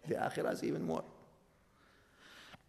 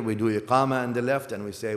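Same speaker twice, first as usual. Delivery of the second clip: normal talking voice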